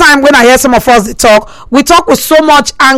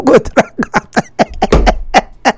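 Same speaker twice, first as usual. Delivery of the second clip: breaking into laughter; 5 dB quieter, much slower than usual. Real, not acted. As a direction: let the question dissolve into laughter. Host cracks up at own joke.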